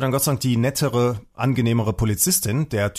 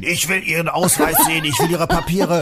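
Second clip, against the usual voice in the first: mock shouted demand, raised pitch; re-enacted yelling; 4 semitones higher than usual. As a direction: replay yelling at someone, half laughing. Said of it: "hab den angeschrien". (nachgespieltes Anschreien)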